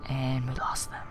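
whispered repeat. Real, not acted. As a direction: whisper it under your breath.

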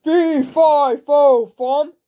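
booming, loud giant roar; giant's shout. A narrator's reading. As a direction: bellow each word like a giant. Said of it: "when the giant appeared shouting".

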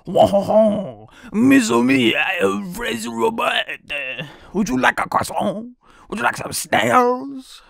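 loud hammed-up French accent; exaggerated fake French; mock French accent. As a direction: offer croissants as a silly French waiter.

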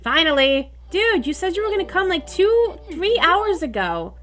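high, exasperated telling-off; indignant complaint. Real, not acted. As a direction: take the late repairman to task. Complaining to late repair person.

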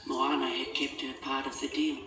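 ordinary delivery

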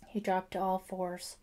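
usual speaking voice

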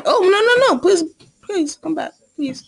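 frantic high pleading; desperate please come back. Real, not acted. Begging someone to come back.

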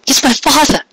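high, tearful repeat; tinged with tears. Acted. Cupcake repeating to Carrot, tearful. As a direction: say it again on the edge of crying. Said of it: "her voice again tinged with tears".

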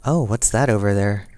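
normal talking voice

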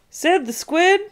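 high, loud questioning call; calling through a door. Acted. Harry bangs on bathroom door.

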